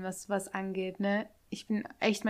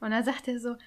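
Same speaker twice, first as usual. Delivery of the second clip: usual speaking voice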